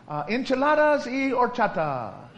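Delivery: high, loud and slow, savoring each word; proud rehearsed order. Retold or imitated. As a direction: announce the new phrase with pride. American rehearsing new order. (pronunciando con orgullo la frase nueva)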